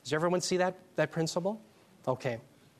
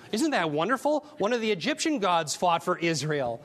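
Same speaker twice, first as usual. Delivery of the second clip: facetious; 3 semitones higher than usual. Acted. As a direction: sell the sarcasm with a bright rise on Isn't. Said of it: "I'm being facetious".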